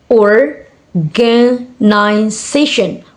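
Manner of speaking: organization very slowly, in separate beats; syllable by syllable. Shown as a demonstration. Teacher showing five syllable word, syllable by syllable. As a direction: pause between the syllables of organization. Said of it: "five syllable words".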